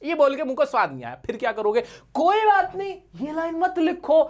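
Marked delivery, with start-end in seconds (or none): 2.1-4.2 much higher-pitched, indulgent let-off; playful concession. Shown as a demonstration. Teacher lets students skip a disliked line, high playful concession. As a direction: let them off in a raised, playful voice.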